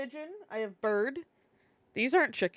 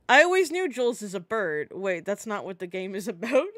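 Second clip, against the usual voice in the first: ordinary talk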